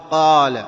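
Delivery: "قال" with a strong, loud uvular q; clear qaf. Shown as a demonstration. Teacher demands a true qaf, loud q in "قال". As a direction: split the qaf cleanly in "قال". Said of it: "من شق القاف، فنقول".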